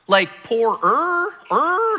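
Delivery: mock alarm at the word poorer; questioning poorer. Pastor jokes about the vow word poorer, rising in mock alarm. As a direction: stretch poorer slowly, rising to a high, loud er. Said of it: "what kind of er are we talking about".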